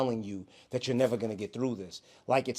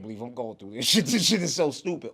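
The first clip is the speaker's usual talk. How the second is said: laughing; 4 dB louder than usual. Retold or imitated. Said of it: "inner narrator could laugh".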